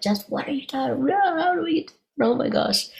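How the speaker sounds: high-pitched panicked imitation of the tourists; mock panicked crowd.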